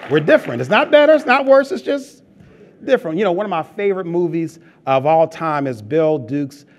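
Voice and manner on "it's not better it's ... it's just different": preaching emphasis. Real, not acted. Preacher's emphatic point to congregation.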